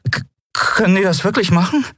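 high, stammered question; startled fright. A narrator's reading. Miko asks whether authorities can do that, frightened. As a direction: stumble on the first sound, pitch high. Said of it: "riss erschrocken die Augen auf".

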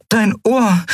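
high-pitched worried voice; note his injury, high and concerned; concerned heroine. A narrator's reading. Heroine worried about his ear. (hohe, besorgte Frauenstimme)

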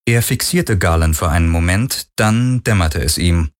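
ordinary delivery

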